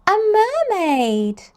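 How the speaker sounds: ordinary delivery, nothing marked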